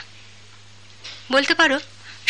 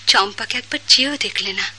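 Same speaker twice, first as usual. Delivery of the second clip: ordinary delivery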